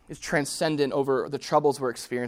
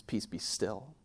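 hushed command; 4 semitones lower, 7 dB quieter, slower than usual. Retold or imitated. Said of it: "He says".

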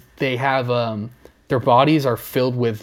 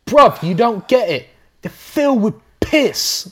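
higher, slow, mock nature narrator; venomous Attenborough; 8 semitones higher than usual. Playing the narrator insisting to his friends, exasperated. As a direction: lay it on thick like an exasperated host. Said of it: "He has a lot of venom towards them".

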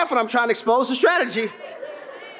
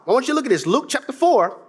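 usual speaking voice

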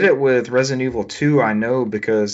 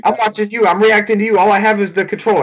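much higher, whiny offended protest; indignant game voice; 10 semitones higher than usual. Pretend. Co-host playing the video game, complaining to the player in a high whine. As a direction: act the game defending itself, hurt and shrill.

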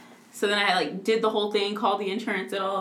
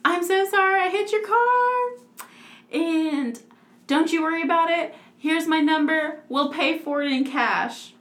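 put-on apologetic voice; 7 semitones higher than usual. Retelling the note from whoever hit the car, mock-sweet high voice. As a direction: imitate a sweetly sorry stranger, easing down by the end.

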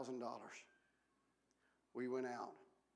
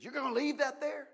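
surprised question; 10 semitones higher, 9 dB louder than usual. Retold or imitated. Retold rich man's surprised question.

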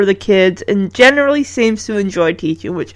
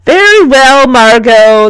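loud, resigned teacher, falling in pitch; giving in; 3 semitones higher, 5 dB louder than usual. A narrator's reading. Teacher gives in to Margot, reluctantly yielding. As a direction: give in to an insistent pupil. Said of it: "the teacher gives in".

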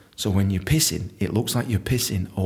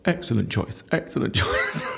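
mock waiter; 6 semitones higher, 3 dB quieter than usual. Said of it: "the waiter will, nod back".

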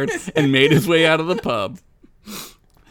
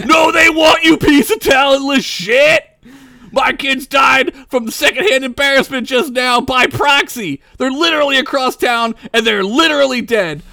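higher, louder outraged shout; nobleman yelling; 10 semitones higher, 6 dB louder than usual. Acted. Nobleman blaming the bard for his dead kids, outraged shout. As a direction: scream the grievance, near tears. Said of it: "yelled a nobleman".

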